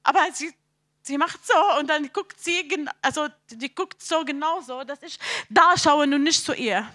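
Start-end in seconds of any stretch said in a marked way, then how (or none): none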